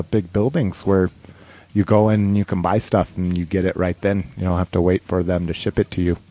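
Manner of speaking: ordinary talk, nothing marked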